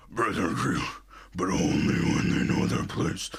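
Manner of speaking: low gravelly hero growl, imitating; Batman voice. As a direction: growl it like a caped vigilante. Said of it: "he's doing the Batman voice".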